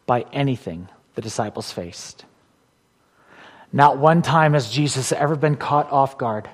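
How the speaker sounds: ordinary talk, nothing marked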